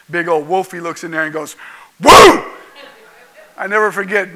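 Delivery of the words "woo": sudden loud, high woof; short, raised mock bark; imitated dog bark. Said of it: "my dog barked at the gerbil".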